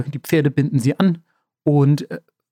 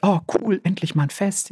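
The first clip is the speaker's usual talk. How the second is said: higher-pitched, lightly voiced delight; mildly excited daughter; 5 semitones higher, 3 dB quieter than usual. Daughter delighted about the festival. (leicht begeisterte Tochter)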